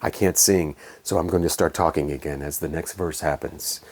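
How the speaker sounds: pulling back into low, quiet talk. Retold or imitated. Reviewer mimics the singer remembering he can't sing, low hushed talk. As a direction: imitate a crooner retreating from singing into a soft murmur. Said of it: "then he pulls it back".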